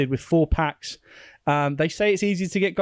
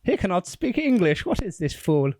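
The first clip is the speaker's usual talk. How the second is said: haughty mock voice; 4 semitones higher than usual. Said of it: "Tut, tut".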